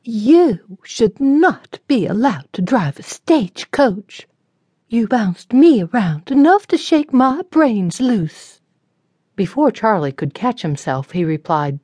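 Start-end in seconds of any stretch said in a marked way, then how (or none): 0.0-8.6 put-on high, slow, complaining young lady; haughty young lady. A narrator's reading. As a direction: scold the driver from above. Sarah voiced, scolding the driver.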